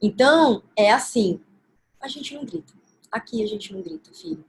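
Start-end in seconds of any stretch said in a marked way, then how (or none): 2.0-4.4 kneel down and say it almost under your breath; soft, gentle tone. Shown as a demonstration. Mother crouching beside her screaming child, very soft and gentle. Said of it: "nesse tom".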